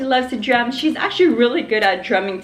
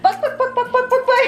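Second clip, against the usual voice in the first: very high laughing bak bak; chicken clucking with laughter; 14 semitones higher than usual. Pretend. Mother clucking like a chicken for her laughing baby, high and giggly. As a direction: cluck like a hen while giggling. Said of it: "Like a little chicken".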